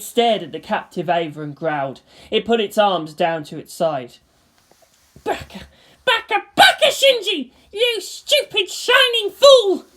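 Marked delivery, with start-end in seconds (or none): 5.2-9.9 high, loud cry breaking with emotion; furious sobbing shout. Acted. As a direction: yell at him, voice cracking. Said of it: "Asuka shouted, her voice breaking".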